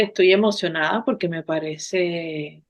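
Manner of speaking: ordinary talk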